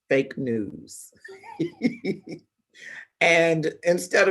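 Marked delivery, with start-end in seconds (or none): 1.2-2.4 incredulous laugh. Real, not acted. Laughs at being put on hold.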